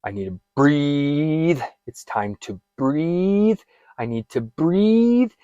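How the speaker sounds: slow, rising, pleading reminder to breathe; slow self-reassurance. Shown as a demonstration. Coach showing what to tell yourself, slow pleading. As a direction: talk yourself down gently and slowly. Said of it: "I need you to tell yourself".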